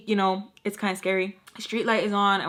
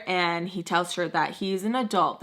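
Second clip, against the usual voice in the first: ordinary talk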